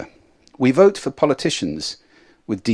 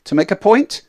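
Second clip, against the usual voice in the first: loud, higher-pitched emphatic volume; 8 semitones higher, 6 dB louder than usual. Shown as a demonstration. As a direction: raise your volume to make the point. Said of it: "getting very loud".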